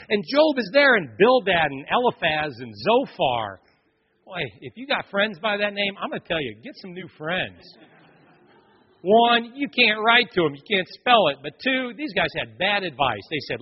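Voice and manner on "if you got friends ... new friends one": ordinary talk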